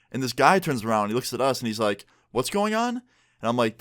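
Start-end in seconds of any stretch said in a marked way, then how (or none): 2.3-3.0 high puzzled question; a confused guy, voice shooting up. Retold or imitated.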